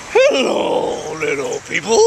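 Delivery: booming hello, starting very high then dropping; big turtle voice. Acted. Giant turtle greeting people in a canoe, put-on creature voice. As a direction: greet them as a huge cartoon creature.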